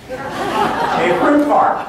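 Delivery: slow, rising, laughing "A fruit farm"; mock disbelief. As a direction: drag it out with an amused rising disbelief. Caller learns farm grows fruit.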